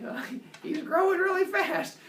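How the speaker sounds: a high, giggly Maya voice; laughing Maya. Retold or imitated.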